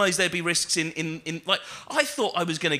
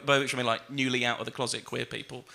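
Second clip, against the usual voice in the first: ordinary talk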